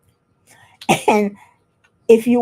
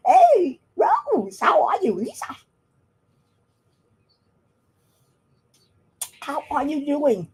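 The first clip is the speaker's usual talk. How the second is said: mimicking the pet's chatter in a pitched-up voice; imitating the bird; 8 semitones higher than usual. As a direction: chirp the hello up high like a talking bird. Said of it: "You heard him, Dawn?".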